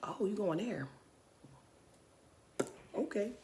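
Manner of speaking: quiet knowing aside. Retold or imitated. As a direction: murmur it to yourself. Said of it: "I was just like".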